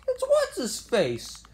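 "what's-his-face" jumps up high as the voice cracks; sudden high squeaky crack. Real, not acted. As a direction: let the voice crack high and slow. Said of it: "voice crack for a second there".

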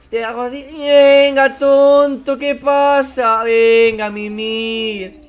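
high, loud sing-song taunt; loud mocking taunt. Mocking the knocked-out director, high and loud. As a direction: gloat over him as he falls.

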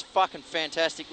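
usual speaking voice

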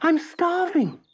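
high, begging puppet voice; pleading. A narrator's reading. Starving Pinocchio pleads with the snail for food. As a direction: beg for something to eat. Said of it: "pleaded the puppet".

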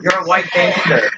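lightly voiced gangster taunt; mock gangster voice. Shown as a demonstration. Friend teasing the gamer with a lightly voiced gangster line. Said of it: "You're not a gangster".

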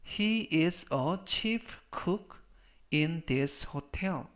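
very slow, word by word; slow model reading. Shown as a demonstration. Teacher models the hotel chef sentence for learners, slow model reading. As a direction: say each word slowly for learners to repeat.

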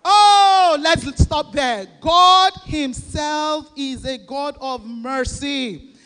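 very loud, slowed, exultant preacher cry; a pastor erupts into loud preaching; fiery preaching. Real, not acted. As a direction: break out in full preaching fervor. Preaching burst.

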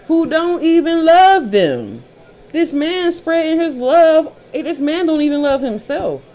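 scolding loudly from the top of the voice; high loud rant. Real, not acted. Ranting at rival women.

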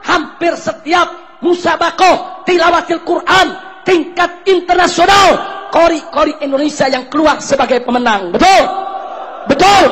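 rising victory cry; triumphant building shout. Real, not acted. Preacher boasting to the crowd, building to a shout.

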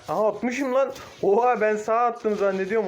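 surprised. Real, not acted. Surprised at own skill shot.

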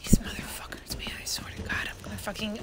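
unvoiced, defeated whisper; whispered despair. Real, not acted. Player at the end of their rope, whispering. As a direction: whisper the cursing to yourself.

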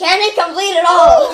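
loud, laughing excitement; excited laughing. Real, not acted. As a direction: cheer him on, laughing. Cheering on the chugging friend.

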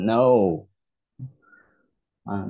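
usual speaking voice

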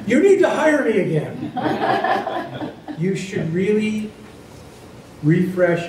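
high, slow, laughing through the words; laughing joke. Real, not acted. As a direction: tease them while laughing at yourself. Joking about being hired again.

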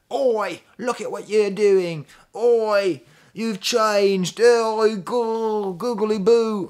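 hammed-up London accent, high and loud; loud, drawn-out mock southern London wail; British accent. Singer scolding his mirror image in mock British accent. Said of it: "British accent".

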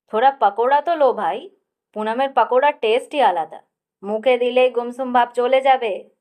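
loud hearty call. A narrator's reading. Akash urging everyone to eat pakoras, loud hearty call. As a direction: boom it out, offering the pakoras. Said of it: "আকাশ ফের হাঁক পারল".

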